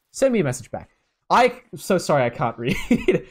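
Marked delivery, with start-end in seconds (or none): 1.3-3.3 slow, giggling through the words; amused, breaking into laughter; laughing apology. Real, not acted. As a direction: laugh at your own bad reading. Laughs at his own misreading.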